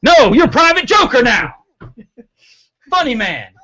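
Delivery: drill sergeant. Pretend. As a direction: yell it like a drill instructor. Host renames cohost like Hartman.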